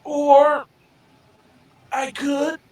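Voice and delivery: high-pitched, hesitant confession; cagey crew member confessing. Acted. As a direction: confess in a high voice, backpedalling and placating. In-character reluctant confession to crew.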